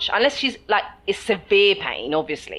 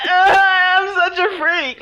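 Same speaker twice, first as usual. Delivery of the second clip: high, loud mock wail; hammed-up despairing cry at herself; mock anguish, pitched way up; self-pity wail; 11 semitones higher, 8 dB louder, much slower than usual. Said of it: "I'm like".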